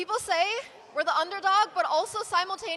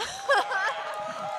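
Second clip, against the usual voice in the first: bright burst of laughter; 10 semitones higher, 4 dB louder than usual. Real, not acted. Player laughs after joke answer.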